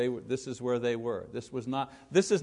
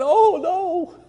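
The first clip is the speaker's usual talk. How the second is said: high, loud mock alarm; hammed-up surprised cry; mock shock; 12 dB louder than usual. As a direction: yelp in pretend horror at the words. Mock shock at Bible text.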